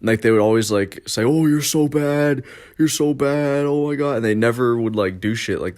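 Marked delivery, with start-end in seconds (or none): 1.2-4.2 mildly raised, sing-song taunt; lightly mock the bratty teammates; mocking kids. Kids taunting him at practice.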